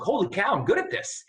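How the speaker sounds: delighted. Retold or imitated. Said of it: "And I was like".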